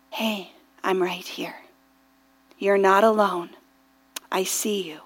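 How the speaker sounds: very soft, low comforting voice; gentle assurance. Retold or imitated. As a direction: speak tenderly, barely above a hush, with pauses. Voicing the Lord's gentle assurance.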